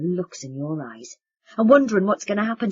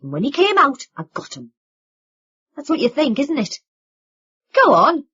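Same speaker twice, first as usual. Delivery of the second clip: angry accusation; 2 semitones higher, 8 dB louder than usual. Acted. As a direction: spit the accusation at them. Vin accusing family.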